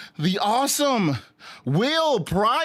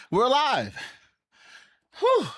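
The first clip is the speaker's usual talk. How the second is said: usual speaking voice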